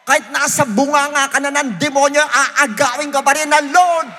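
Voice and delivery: loud, high shouted preaching; preaching at full force. Acted. Preacher declares God is stronger, in a full-force shout. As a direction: shout it out to the whole church. Closing climax.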